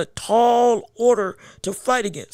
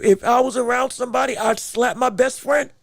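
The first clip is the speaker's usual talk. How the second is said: ordinary delivery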